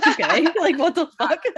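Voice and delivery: high, loud laughing retort; laughing disbelief. Retold or imitated. Kendall reacting to the joke, high laughing disbelief. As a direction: laugh it out, half scandalised. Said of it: "And Kendall's like".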